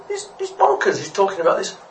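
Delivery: very high, spluttering fish voice; scandalised gossip. Acted. Second fish telling others about mad talk, scandalised. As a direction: splutter the news in squeaky disbelief.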